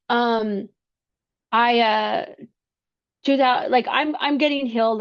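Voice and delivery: ordinary talk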